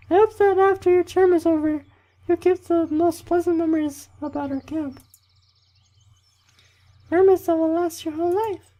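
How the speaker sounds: loud, squeaky falsetto camp leader giving a speech; hammed-up mock female falsetto; bad girl voice. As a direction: lecture in a badly faked, very high female voice. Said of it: "That was a really bad girl voice".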